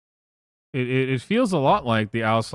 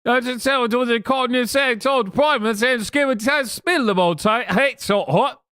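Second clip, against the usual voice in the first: high-pitched, louder mock Cockney babble; fake Cockney accent; 12 semitones higher, 4 dB louder than usual. Streamer mocking a British chatter in a fake Cockney accent. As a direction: ham up a rough London street accent.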